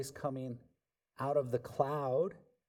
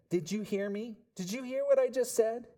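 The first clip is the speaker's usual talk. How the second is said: a hint of an exasperated mom, pitch climbing; imitated mom; 6 semitones higher than usual. Preacher plays a mom scolding her child, mildly exasperated. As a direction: mimic a mom who's been ignored.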